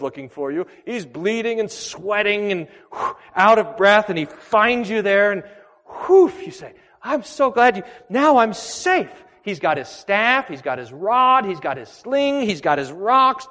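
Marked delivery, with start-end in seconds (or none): 5.9-9.1 high, breathless relief, slowing on now I'm safe; relieved sheep. Acted. Lost sheep seeing the shepherd arrive, high and relieved. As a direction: gasp with relief as rescue arrives.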